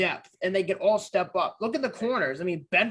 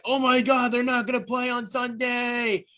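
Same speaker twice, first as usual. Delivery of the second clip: higher-pitched mock panic, drawing out the end; mock freak-out; 5 semitones higher than usual. Fan sees the injury list, mock panicked wail. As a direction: imitate fans freaking out in a raised voice. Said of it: "they freaked the fuck out".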